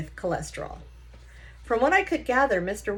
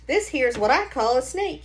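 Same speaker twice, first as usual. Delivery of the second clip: put-on higher, louder folksy old grandpa; old Gramps voice; 5 semitones higher, 5 dB louder than usual. Grandpa poking at a stranger's engine, confident folksy voice. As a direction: play the know-it-all grandpa tinkering.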